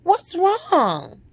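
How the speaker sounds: high-pitched retold question; concerned mother. Mother sees her daughter crying, high concerned question.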